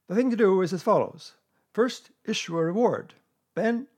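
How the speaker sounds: loud, high-pitched Owl; emphatic Owl explanation. A narrator's reading. Owl explaining to a puzzled bear, emphatic.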